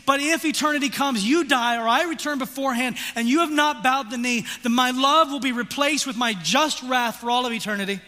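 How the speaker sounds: loud, high, pleading voice of God; God's voice thundering a final offer; voicing God. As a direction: proclaim as God, urgent and loud. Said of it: "has withheld his wrath and saying".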